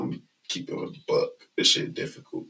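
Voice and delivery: usual speaking voice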